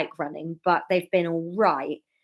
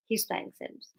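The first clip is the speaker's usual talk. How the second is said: whispered aside; whispering; 11 dB quieter than usual. Real, not acted. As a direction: whisper it behind his back. Aside about partner gaming.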